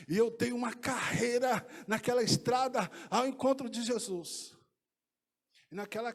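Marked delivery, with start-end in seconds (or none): none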